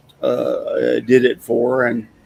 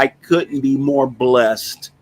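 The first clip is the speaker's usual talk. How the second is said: drawn-out, heartfelt "I couldn't be more blessed"; slow and earnest; slower than usual. Real, not acted. Grateful remark about neighbors.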